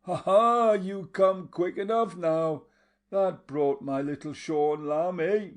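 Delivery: high, loud mocking laugh into the words; teasing her lover with a wild laugh. A narrator's reading. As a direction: laugh wildly, taunting him. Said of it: "The girl broke into a wild laugh".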